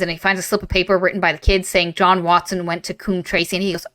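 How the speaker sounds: ordinary talk, nothing marked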